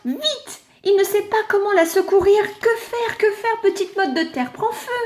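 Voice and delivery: high, rushed worried voice; panicked sun. Acted. The horrified sun panicking over the burning clod. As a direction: fret helplessly, not knowing what to do.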